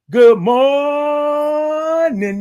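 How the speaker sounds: "morning" with a very long, high "mor"; drawn-out greeting. Real, not acted. Host greeting five oh four girl, drawn-out singsong "morning". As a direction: hold "morning" out high, then drop on "-nin". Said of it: "I love all those O's and R's and I N Gs".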